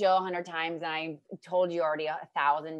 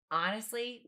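normal talking voice

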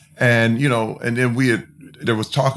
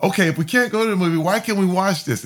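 put-on curious kid questioning; 9 semitones higher, faster than usual. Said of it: "And I was like".

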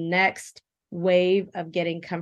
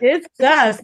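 loud, high punchline; cheeky slang quip; playful slang; 7 semitones higher, 9 dB louder than usual. Real, not acted. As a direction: land the slang with sass. Slang punchline after laugh.

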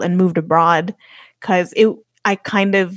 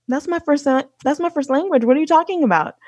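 retelling a baffled comeback, pitched up; incredulous retort; 6 semitones higher, faster than usual. Retold or imitated. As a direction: push back in mild mock disbelief, higher voice. Retold retort to backhanded praise.

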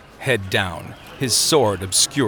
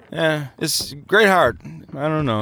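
higher, tipsy muddle; slurred drunk; 5 semitones higher than usual. A narrator's reading. Drunk man teetering on the bench, slurring. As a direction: slur it, losing the thread. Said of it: "he slurred".

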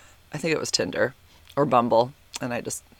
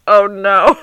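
mock dismay; 5 semitones higher, 10 dB louder than usual. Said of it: "I'm like".

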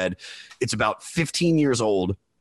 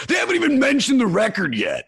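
annoyed fan; 12 semitones higher than usual. Retold or imitated. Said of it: "He's like".

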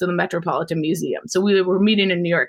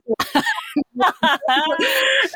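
a real high squeal of laughter; 13 semitones higher than usual. Real, not acted. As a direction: burst out in a high shrieking laugh. Retelling the brother mix-up.

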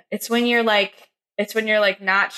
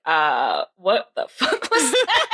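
slow, laughing imitation of an appalled Brit; imitating British podcaster; much slower than usual. Host retelling the UK friend's reaction, imitated British disbelief. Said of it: "he was like".

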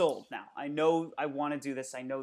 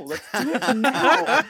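excited; 8 semitones higher, 14 dB louder, slower than usual. Retold or imitated. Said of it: "And I was like".

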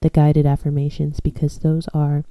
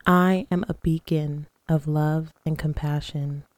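guided meditation. Acted. Leads guided breathing and affirmations.

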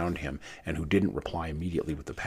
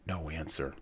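ordinary delivery

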